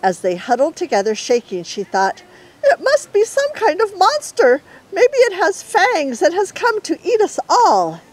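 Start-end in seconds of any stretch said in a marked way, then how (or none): 2.6-8.0 much higher, tiny scared voice; small trembling creature; frightened little animal. Acted. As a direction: squeak out the fear of a monster.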